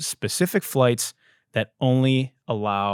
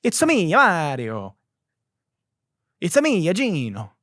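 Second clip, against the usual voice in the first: Mario impression; 7 semitones higher than usual. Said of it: "Mario-style joke".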